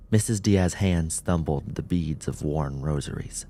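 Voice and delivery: usual speaking voice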